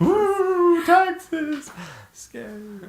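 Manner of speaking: high mock ghostly woo; hammed-up scary voice; mock spooky voice.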